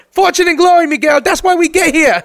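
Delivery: put-on high, loud excited kid sidekick; Short Round. Body part to its owner, squeaky sidekick voice. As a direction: pipe it up in squeaky accented urgency. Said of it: "talks to me like Short Round".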